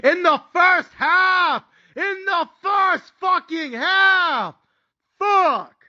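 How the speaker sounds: high, incredulous angry shout; big mad. Retold or imitated. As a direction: stretch each word in outrage. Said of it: "Now I'm big mad".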